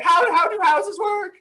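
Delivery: high, slow, bewildered wail; mock bewilderment. Playing a memory-loss character baffled at IKEA, mock bewilderment. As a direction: play someone who forgot how houses work.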